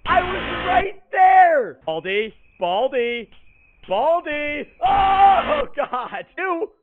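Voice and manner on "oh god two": ordinary delivery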